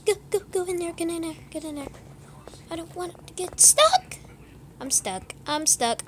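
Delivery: ordinary talk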